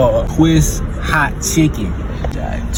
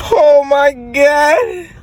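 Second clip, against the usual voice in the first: high, slow, squealed oh my God; shocked exclaim; 13 semitones higher, 3 dB louder, much slower than usual. Real, not acted. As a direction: gasp it out, overwhelmed. Reacting to hot food.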